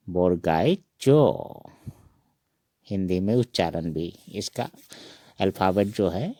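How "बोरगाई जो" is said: बोरगाई जो said slowly, stressed on जो; slow and clear बोरगाई जो; Assamese letter name. Shown as a demonstration. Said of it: "इसका उच्चारण हम पढ़ेंगे". (धीरे से बोरगाई जो)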